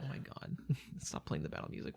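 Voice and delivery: whispered laughing plea. Real, not acted. GM begging player to stop the music, whispered. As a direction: breathe it out through laughter.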